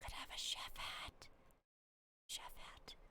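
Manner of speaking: breathy whispered wonder. Real, not acted. Streamer whispering to herself about a chef hat. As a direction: hush it like a secret. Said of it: "I don't know why I'm whispering".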